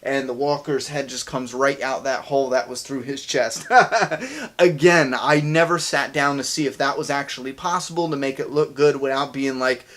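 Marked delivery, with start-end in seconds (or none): 3.7-4.5 a quick high laugh at the gore; short laugh. Real, not acted. YouTuber laughing at his own gory walker idea, short laugh. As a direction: laugh it off, amused at how wild it sounds.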